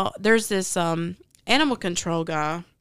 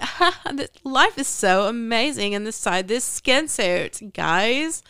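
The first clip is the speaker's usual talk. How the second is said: higher, gushing put-on cheer, drawn out at the end; fake jovial voice; 4 semitones higher, 3 dB louder, slower than usual. Retelling how the character was, in a mock fake-jovial high voice. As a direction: imitate a phony, delighted otherworldly being. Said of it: "Anyway, that's how she was".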